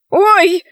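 sudden high "oy"; shocked little cry; high startled yelp. A narrator's reading. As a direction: yelp in alarm. Cannibal's beard is cut.

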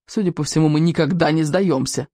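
ordinary delivery

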